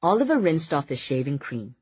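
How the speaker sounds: ordinary delivery, nothing marked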